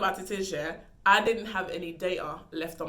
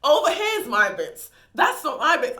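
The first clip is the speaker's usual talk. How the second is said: louder, higher, slower insistence; emphatic louder insistence; 7 semitones higher, 8 dB louder than usual. Real, not acted. Telling listeners where her area is, loud and insistent. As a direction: plant it firmly, louder and higher.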